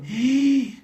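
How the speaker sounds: high, drawn-out imitated gasp; shocked gasp. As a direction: gasp like horrified students.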